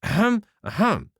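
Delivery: mock pompous throat-clearing; imitating the colonel. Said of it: "And he says".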